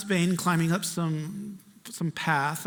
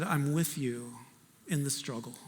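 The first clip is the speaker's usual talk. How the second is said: softer, tender reassuring voice; gentle voice of God; 5 semitones lower, 7 dB quieter than usual. Retold or imitated. Preacher voicing the Lord to the congregation, soft and tender. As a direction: speak it softly, as God comforting.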